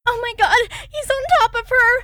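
very high horrified cry; horrified girl. Acted. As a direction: gasp it out in terror. Girl sees friend attacked.

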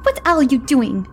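high, sharp barked demand. Acted. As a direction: bark it at her. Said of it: "LaChapelle barked".

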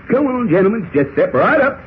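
high sing-out bidding call; playful auction patter, pitched high; mock auctioneer. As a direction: work the crowd like an auctioneer. Cowboy mock-auctions himself to captors.

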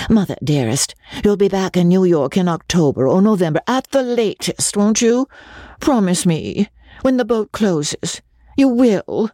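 a daughter torn at leaving, voice catching; tearful daughter. Acted.

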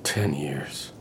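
breathy whisper, barely voiced; hushed memory. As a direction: whisper it to yourself. Whispered, then repeated aloud.

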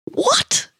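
very high, disbelieving scoff. A narrator's reading. Amanda dismissing the Scotsman's claim, scoffing. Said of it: "scoffed Amanda".

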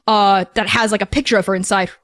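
normal talking voice

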